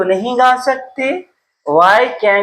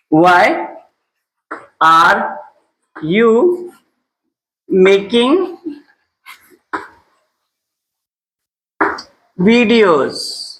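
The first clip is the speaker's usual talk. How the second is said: each word alone, long pauses between; halting teacher's dictation pace; 3 semitones lower, much slower than usual. Shown as a demonstration. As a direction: dictate it slowly, one word at a time.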